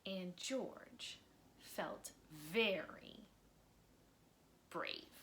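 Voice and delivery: expressive read-aloud storytelling; lively children's book narrator; story narration. A narrator's reading. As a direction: read it like bedtime story time for kids. Reading a picture book aloud.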